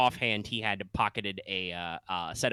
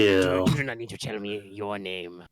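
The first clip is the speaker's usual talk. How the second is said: louder, menacing shadow creature; spooky shadow werewolf voice; 5 dB louder than usual. Acted. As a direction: growl it out as a shadow beast. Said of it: "my spooky shadow werewolf voice".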